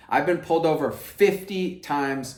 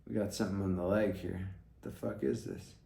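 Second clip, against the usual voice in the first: muttered aside; 6 semitones lower, 9 dB quieter than usual. Real, not acted. Picks something off his leg.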